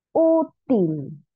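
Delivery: Útil split, with long stressed Ú; stress on penultimate syllable. Shown as a demonstration. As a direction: stretch the Ú of Útil, then drop on til. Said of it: "Tiene el acento en la penúltima".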